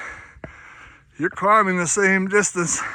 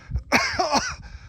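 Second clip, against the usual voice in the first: coughing fit; 5 dB quieter than usual. Real, not acted. Coughing after climb.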